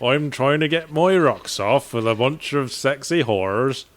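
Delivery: slow, drawling West Country farmer; West Country accent. Pretend. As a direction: play a jolly West Country farmer, drawn out. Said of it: "do the whole thing in a West Country accent".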